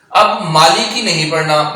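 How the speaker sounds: normal talking voice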